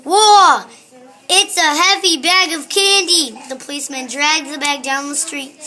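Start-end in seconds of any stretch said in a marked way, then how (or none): 0.1-3.4 loud, high, slow effort; straining exclamation. A narrator's reading. Policeman lifts the boy's heavy candy bag, straining shout. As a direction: heave each word out under a great weight.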